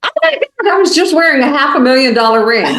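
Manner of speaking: high, fast squeal of disbelief; amazed. Retold or imitated. Barbara's shock outside the store.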